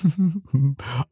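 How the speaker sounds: a high, bubbling burst of laughter; amused laugh. Acted. Giant watching the tiny human gobble food, high amused laugh. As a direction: laugh helplessly at something adorable.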